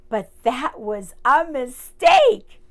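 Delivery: big loud storyteller warning; loud dramatic. Acted. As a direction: boom it out, then a sneeze. Ant hits the pepper.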